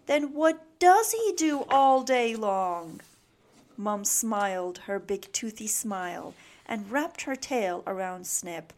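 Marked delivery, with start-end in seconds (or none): 0.1-3.0 loud, raised, exasperated question; crying out. A narrator's reading. Snip asking Mom what Dad does, crying out. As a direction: demand an answer, leaning on key words. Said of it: "cried Snip".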